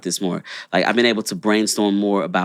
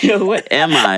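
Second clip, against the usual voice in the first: laughing; 6 semitones higher, 4 dB louder than usual. Real, not acted. Laughs at own phrasing.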